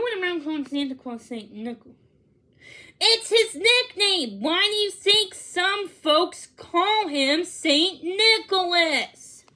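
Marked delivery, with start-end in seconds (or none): 3.0-9.5 mock indignant. A narrator's reading. Defensive kid voice arguing.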